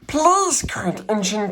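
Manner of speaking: high begging plea; pleading cry. A narrator's reading. Toys plead with the old engine, high and begging. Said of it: "cried all the dolls and toys".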